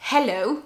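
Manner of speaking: Hello with a clear breathy H; H sounded. Shown as a demonstration. Teacher modelling English H, Hello with aspirated H. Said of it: "Let's look at some examples".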